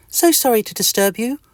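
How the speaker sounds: high, cheery tiny-creature greeting; friendly little itch. Acted. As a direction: greet your neighbour brightly and squeakily. Mister Itch voice.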